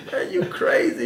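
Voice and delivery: high, loud mocking laugh through the words; laughing disbelief. Officer laughing at inmate's answer, laughing incredulous. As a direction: laugh at him like he's lost it. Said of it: "he started laughing at me".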